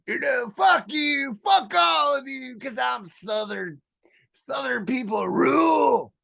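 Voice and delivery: screaming Southern rant. Retold or imitated. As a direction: scream it at the city folk. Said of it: "I'm flying around everything and going".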